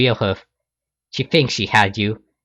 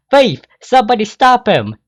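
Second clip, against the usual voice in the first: high loud Southern shout; 11 semitones higher, 7 dB louder than usual. Acted. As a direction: yell for help after the thief. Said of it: "A J shouts".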